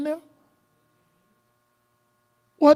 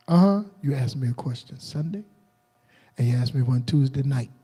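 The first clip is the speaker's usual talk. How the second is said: lower, soft fatherly scolding; a stern older man counting off his questions, voiced lower and softer; imitating Pop; 7 semitones lower, 3 dB quieter than usual. Pop telling him he used his questions up, lower and softer. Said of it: "and Pop said".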